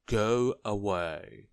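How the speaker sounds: loud, drawn-out rude dismissal; slow, loud and rude. A narrator's reading. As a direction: send them off rudely, stretching the words. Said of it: "said Mister Uppity rudely".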